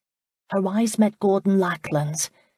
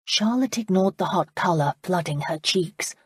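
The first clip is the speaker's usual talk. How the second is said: plain reading voice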